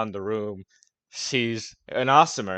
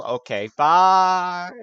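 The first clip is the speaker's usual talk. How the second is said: slow, high and louder giving up; drawn-out exit; 7 semitones higher, 9 dB louder, much slower than usual. Acted. Colt giving up and leaving the table. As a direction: stretch out the goodbye. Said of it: "Colt's like".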